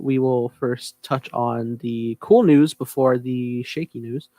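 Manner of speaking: usual speaking voice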